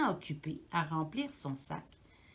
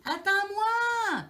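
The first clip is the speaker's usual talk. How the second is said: high, loud, slow drawn-out cry; little mouse shouting high and slow; 13 semitones higher, 9 dB louder, much slower than usual. A narrator's reading. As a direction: stretch the cry out to reach him. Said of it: "Il crie".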